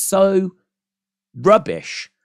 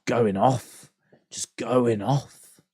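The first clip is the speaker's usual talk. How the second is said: slow drawn; 3 semitones lower, 4 dB quieter, much slower than usual. Real, not acted. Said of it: "drawn out repetition".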